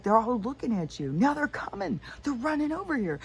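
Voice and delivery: high, rising panic as they come; urgent, frightened, voice up high; panicked warning. Retold or imitated. Husband warning the cop the demons are running over, high panic. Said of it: "He's like".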